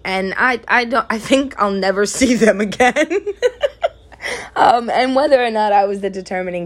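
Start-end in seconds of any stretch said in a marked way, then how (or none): 3.0-3.9 high, short burst of laughter; real laugh. Real, not acted. Laughing after punchline.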